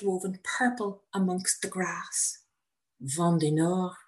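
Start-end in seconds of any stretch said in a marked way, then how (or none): 3.0-4.0 French instructor. Retold or imitated. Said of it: "says Jean-Claude".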